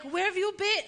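put-on a mild take on an old Italian grandpa's accent. Old Italian grandpa greeting her, in a light Italian accent. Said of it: "And his accent is so thick".

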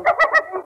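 hysterical laugh. Acted. Wife laughs hysterically after murder.

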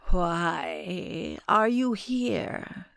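very slow, otherworldly guardian; eerie spirit voice. A narrator's reading. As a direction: speak like an ancient spirit.

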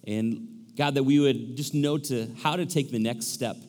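low, soft prayer voice; earnest, subdued pastor's prayer. Real, not acted. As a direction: pray aloud, hushed and low. Leads a prayer.

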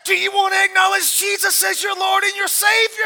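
loud, high preacher's appeal; mock-earnest altar call shout. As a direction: call the cat to salvation at full voice. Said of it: "practicing the altar call".

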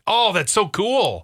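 high, slow, drawn-out delight; delighted gushing. Real, not acted. Host reacting to a South African accent, delighted gushing. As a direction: gush with a high swoop.

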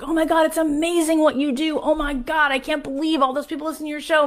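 high, fast gushing fan voice, caught in admiration. Retold or imitated. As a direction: play an adoring fan, breathless with praise. People gushing over her show.

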